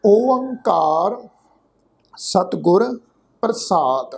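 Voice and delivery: very slow, stretched syllables; measured Gurbani invocation; sacred invocation. Shown as a demonstration. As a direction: intone it word by word with long pauses. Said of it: "ਪਾਠ ਉਚਾਰਨ ਕਰਦੇ ਹਾਂ ਜੀ".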